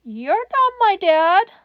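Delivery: put-on small cracked child's voice, high and slow; a frightened little kid on the phone; high, slow, cracking kid voice; small scared child. A narrator's reading. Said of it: "cracked and scared".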